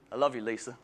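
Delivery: low, soft murmured aside; intimate aside. Acted. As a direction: drop low and soft for a private endearment. Intimate aside after formal thanks.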